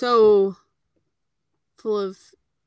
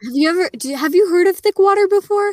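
incredulous; 8 semitones higher, 6 dB louder than usual. Real, not acted. Incredulous question about thick water.

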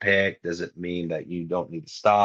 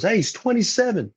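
jumps way higher to call it out; calling the age back into the room, pitched up; high mock announcer; 12 semitones higher than usual. Door guy relays the age, voice pitched way up. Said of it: "and he goes".

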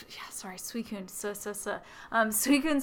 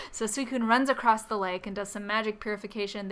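normal talking voice

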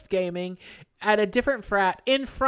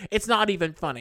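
ordinary talk

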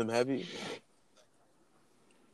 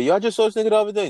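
quick, higher-pitched quoted question; puzzled friends asking; 8 semitones higher, 3 dB louder, faster than usual. Retold or imitated. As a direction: mimic someone confused where he went. Imitating people asking for him.

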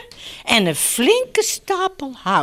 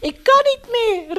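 high-pitched despairing call; exhausted little dog, desperate and high; desperate cry; 8 semitones higher than usual. A narrator's reading. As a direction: cry out at the end of your strength. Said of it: "riep hij wanhopig".

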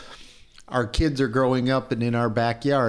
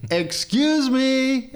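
very high, slow, drawn-out excuse me; insistent call; 13 semitones higher, 3 dB louder, much slower than usual. Retold or imitated. Still ignored, calling over the fence, pitched way up. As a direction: sing it out high to be heard. Calling again, even higher.

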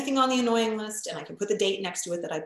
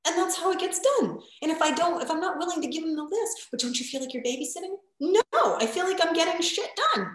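much higher, punchy insistence; emphatic own quote; 10 semitones higher than usual. Retold or imitated. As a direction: insist it gets done. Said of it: "I'm like".

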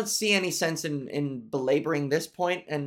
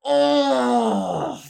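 sudden high pained oh; a real wounded cry; 8 semitones higher, 6 dB louder than usual. Real, not acted. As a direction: cry out as if stung. Hurt by brother's remark.

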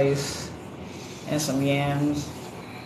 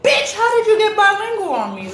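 high, loud disbelieving outburst; exasperated yell; 6 dB louder than usual. Retold or imitated. Mom yelling at her Alexa for switching to Spanish. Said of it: "and I'm like".

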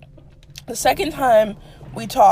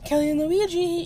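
mock gossiping friend; 6 semitones higher than usual. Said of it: "someone was like".

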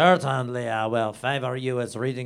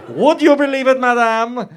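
indignant appeal; 11 semitones higher, 10 dB louder than usual. Acted. Narrator heroine appeals to madame.

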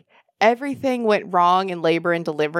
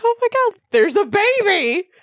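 much higher, awed cry of wonder; amazed; 12 semitones higher, 3 dB louder than usual. Retold or imitated. New parents first seeing their baby, high awed wonder. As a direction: gasp it out in delighted disbelief.

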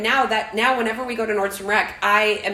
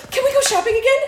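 much higher and louder, bright excited ask; eager kid plea; 6 dB louder, much faster than usual. Retold or imitated. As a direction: voice a little girl begging happily. Daughter eagerly asks again.

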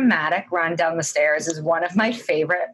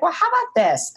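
higher, sweet bargaining princess; 10 semitones higher than usual. Retold or imitated. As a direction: pitch it up like a naive princess.

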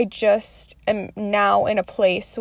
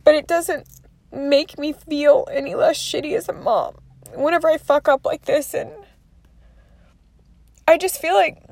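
fighting back crying as she speaks; tearful; 5 semitones higher than usual. Real, not acted. Mother confessing to viewers, close to tears.

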